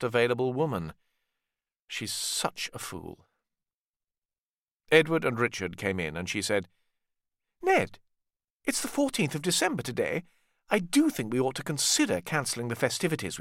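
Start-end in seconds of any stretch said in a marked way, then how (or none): 1.9-3.3 slow, breathy whisper of contempt; whispered thought. A narrator's reading. Cecily thinking to herself, a slow contemptuous whisper. 7.6-13.4 put-on lightly higher elderly mother; a gentle matriarch, lightly voiced; Cecily. Cecily suggests to her son cancelling Christmas, lightly voiced.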